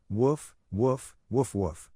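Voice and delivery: playful imitated barking; mock dog barking. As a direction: bark at him like a dog to tease. Teasing by barking at him.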